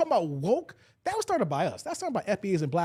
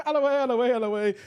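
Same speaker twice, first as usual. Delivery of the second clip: laughing shout; 4 semitones higher, 4 dB louder, much faster than usual. Real, not acted. Laughing, clearing way.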